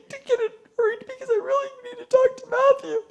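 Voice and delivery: high pleading put-on voice; mock desperate client. Pretending to the booking staff she needs Matthew, high pleading. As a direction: beg sweetly to jump the line.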